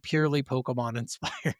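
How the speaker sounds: ordinary delivery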